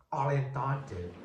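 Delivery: normal talking voice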